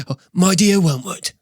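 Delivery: high, put-on British demon voice; Screwtape. Shown as a demonstration. Experienced demon opens a letter to his trainee, put-on character voice. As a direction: voice a senior demon greeting his pupil. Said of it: "that's how he would sound like".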